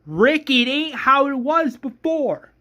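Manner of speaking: high, loud, furious rant; screamed tirade. Acted. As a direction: scream a rallying demand at the group. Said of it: "that's where Shane screams".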